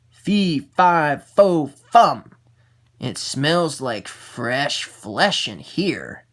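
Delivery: a giant roaring, then savouring the smell; booming giant. Acted. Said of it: "said the giant".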